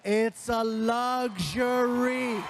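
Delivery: "luxury" hugely drawn out and held; exaggerated shout. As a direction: shout "luxury" slowly, stretching every syllable. Father repeats, exaggerated take.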